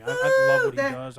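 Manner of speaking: excited. Real, not acted.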